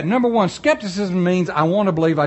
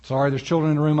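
normal talking voice

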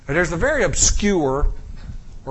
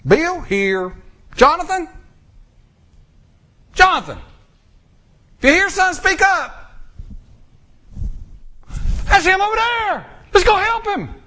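frantic roll call; 13 semitones higher than usual. Retold or imitated. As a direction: call out across the camp. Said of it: "Let's take a roll call".

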